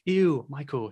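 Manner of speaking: drawn-out "ew" at the name; mock disgust. Explaining the website name, mock-disgusted reaction. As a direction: recoil jokingly from Michael. Acted pun on web address.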